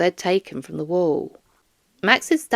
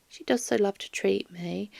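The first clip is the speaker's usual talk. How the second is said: softer, breathy whisper; whispered aside; 7 dB quieter than usual. Acted. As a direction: confide it in a hush. Doris confides softly.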